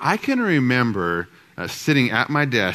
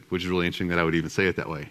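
ordinary delivery

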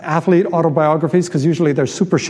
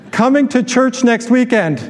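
higher, sustained call-out; pitched-up, chant-like pledge for a crowd to echo; declaration for repeating; 5 semitones higher than usual. Acted. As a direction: lead the congregation line by line. Leads congregation in repeated declaration.

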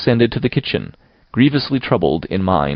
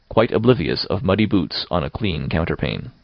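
ordinary delivery, nothing marked

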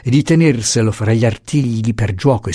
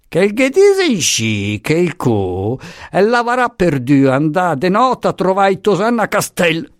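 ranting servant; 9 semitones higher than usual. A narrator's reading. Revived Marianna rails at her master, yelling. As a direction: rail from upstairs in a high voice.

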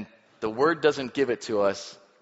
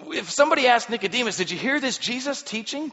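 eager question; 11 semitones higher, 5 dB louder than usual. Acted. Asking Nicodemus about Jesus.

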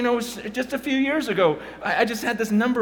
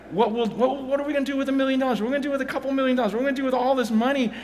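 rapid questions; 4 semitones higher, much faster than usual. Real, not acted.

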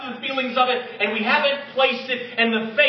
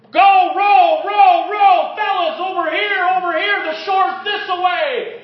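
higher and louder, hammed-up captain calling orders; bossy captain; 9 semitones higher, 7 dB louder, slower than usual. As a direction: bark it like a captain at the bow. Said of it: "with his captain's hat on going".

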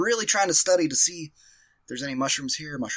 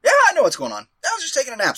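pretend nonchalance; 9 semitones higher, much faster than usual. Dazed, pretending all is fine.

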